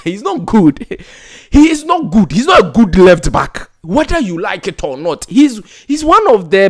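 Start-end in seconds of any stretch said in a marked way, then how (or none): 0.0-1.0 laughing through it; laughing mockery. Real, not acted. Mocking Gideon Mensah, laughing. 1.5-2.3 much higher, HE stressed hard; emphatic. Real, not acted. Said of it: "repeats with emphasis".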